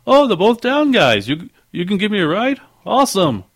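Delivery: upbeat Canadian guy voice; Canadian accent. Retold or imitated. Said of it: "He's like / he's got the accent".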